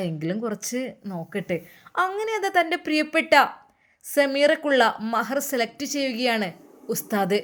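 usual speaking voice

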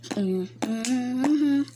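hummed beat; rhythmic humming. Acted. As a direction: hum your own rhythm. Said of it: "I'm using my own rhythm".

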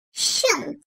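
"Şın" with a soft, drawn-out sh; "Şın" said softly, sh held; Arabic letter shin. Shown as a demonstration. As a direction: demonstrate the shin sound quietly. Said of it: "Dil ortası ile üst damağın ortasından çıkarılır".

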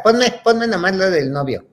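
high, eager little plea; begging child. Retold or imitated. Acting her child self begging mom for the boyfriend part, pleading.

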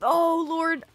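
loud high slow panicked curses; panicking gamer. Real, not acted. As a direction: panic as you're attacked. Panic attacked again.

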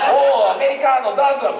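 high, hammed-up Japanese-style greeting; cartoonish Japanese accent, voice way up; mock Japanese. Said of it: "You know, this is all in Japanese".

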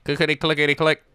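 spoken clickety click noise; imitated clicking. Imitating the creepy guy's neck clicking. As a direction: mimic the machine clicking. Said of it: "Just making a clickety click sound".

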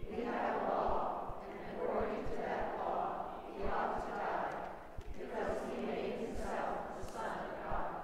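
soft, breathy stage whisper; hushed accusation, no voice; whispered crowd answer. A narrator's reading.

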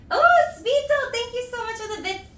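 high, gushing gratitude; excited thanks. Real, not acted. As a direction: burst out loud on the name, then stay high. Thrilled thanks for a donation.

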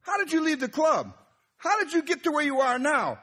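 very high, incredulous rebuke; imitating an indignant scolding from above; Holy Spirit. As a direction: confront with pointed, high-pitched questions. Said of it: "the Holy Spirit showed me".

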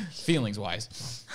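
high laugh around the words; slow words bracketed by laughter. Real, not acted. Laughs at own remark.

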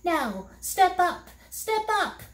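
high, slow, eerie voice; a sly guiding voice, high and drawn out; counseling voice. A narrator's reading. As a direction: lead them on, slow and high. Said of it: "it recommended".

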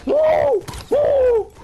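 high loud whoops; excited whooping. Real, not acted. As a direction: whoop like you just won. Cheering while escaping.

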